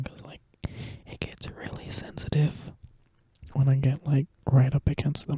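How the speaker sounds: breathy whisper right up on the microphone; close-mic whisper. Real, not acted. ASMR creator to viewers, whispering too close to the mic. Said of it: "I kinda started speaking way too close to the microphone".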